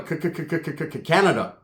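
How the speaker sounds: repeated stuck "Ca-" before the word; stuttering cover-up. Retold or imitated. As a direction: stammer on the first syllable, then land it.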